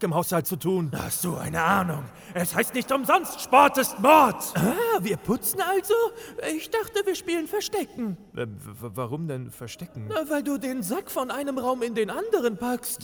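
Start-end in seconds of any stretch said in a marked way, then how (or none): 3.4-4.4 slow and louder, each word weighted; slowly proclaimed saying; proclaimed proverb. Acted. Quoting a proverb as excuse. 8.4-10.0 much lower and softer, stuttering into a laugh; low, soft flustered giggle; stammering laugh. Acted. Stammering confused reply.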